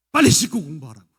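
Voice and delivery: loud impatient demand, stress on 빨리; nagging order. Retold or imitated. Mother pushing teen home late to study, loud and impatient. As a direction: push 빨리 hard and loud. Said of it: "엄마도 그렇게 시키면 안 할걸요".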